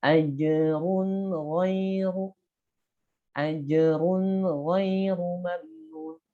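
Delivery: slow ajrun ghairu with a heavy ra; thick ra. Shown as a demonstration. Teacher demonstrates thick ra after dhammah. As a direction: draw out ajrun, ra full and thick. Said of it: "Kalau dhammah".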